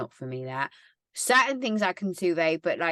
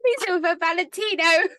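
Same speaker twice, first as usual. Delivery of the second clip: laughing; 10 semitones higher, 6 dB louder than usual. Real, not acted. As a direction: voice high and cracking with laughter. Giggling fit over Lisa.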